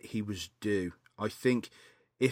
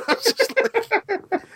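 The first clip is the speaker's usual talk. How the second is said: high, loud burst of laughter; 7 dB louder, much slower than usual. Real, not acted. Laughing at Bad Santa bar scene.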